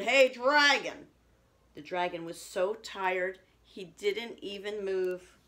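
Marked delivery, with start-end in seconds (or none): 0.0-1.1 loud, slow, higher yell; shouting loudly. A narrator's reading. Princess shouting into the sleeping dragon's ear, very loud. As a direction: yell right into his ear. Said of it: "She shouted as loud as she could".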